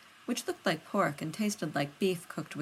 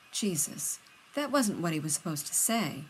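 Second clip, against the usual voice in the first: plain reading voice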